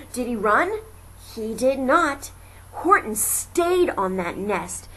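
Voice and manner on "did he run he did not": ordinary delivery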